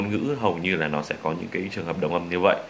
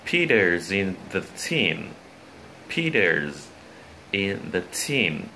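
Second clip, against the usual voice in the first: Peter's and team slowed, long "ee" in each; slow model reading; much slower than usual. Shown as a demonstration. Teacher models a practice sentence, slow careful reading of Peter's in the team. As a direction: read it out slowly, holding the "ee" in team.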